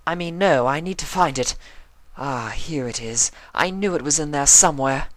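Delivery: high, refined mare, frantic then relieved; lightly voiced flustered Rarity. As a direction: rummage then find it, relieved.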